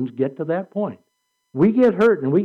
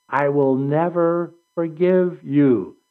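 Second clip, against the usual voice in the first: slow, deliberate vow; slower than usual. Retold or imitated. Vow said slowly as warning.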